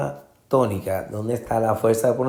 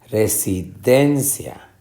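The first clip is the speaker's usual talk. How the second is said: slow residencia with DEN raised; stress on DEN; slower than usual. Shown as a demonstration. Teacher testing options, resi-DEN-cia. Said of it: "den es la sílaba".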